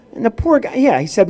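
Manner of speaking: ordinary delivery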